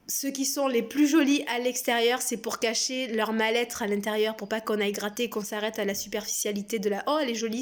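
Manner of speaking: usual speaking voice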